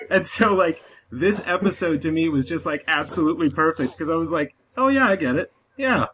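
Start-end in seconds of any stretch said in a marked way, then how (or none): 4.8-6.1 higher-pitched acted agreement; mock revelation, readily convinced; won-over realisation. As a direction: replay coming round to it. Retold change of mind.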